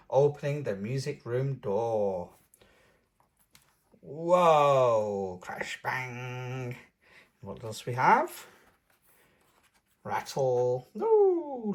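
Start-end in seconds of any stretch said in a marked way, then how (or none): 4.0-6.8 mock crash bang sounds; playful noise mimicry. Reader mimics instruments. 11.0-11.7 high excited ooh; light up at the sight; delighted ooh. Real, not acted. Reader sees instruments.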